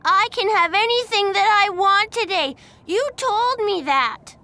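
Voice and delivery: put-on high, loud, tearful child shouting; a crying kid who won't back down; whining tantrum. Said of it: "started shouting again".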